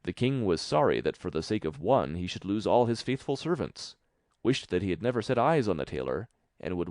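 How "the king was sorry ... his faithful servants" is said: usual speaking voice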